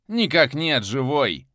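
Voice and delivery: high and loud, snappy soldier's answer; brisk report. Acted. A subordinate briskly reports the prisoner is alive, snappy. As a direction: rap it out like a keen subordinate. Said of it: "Бойко ответил голос".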